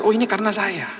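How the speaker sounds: high-pitched, hurt realisation; acted moment of painful understanding; dawning realisation. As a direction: let it sink in that you are the cause. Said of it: "Akhirnya dia sadar".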